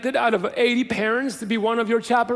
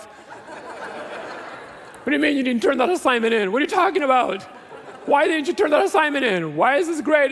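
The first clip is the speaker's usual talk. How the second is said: exasperated parent; 6 semitones higher than usual. Retold or imitated. Pastor imitating a parent scolding about a missing assignment. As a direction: play a parent fed up over a missing assignment. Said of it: "I'm doing someone else's voice".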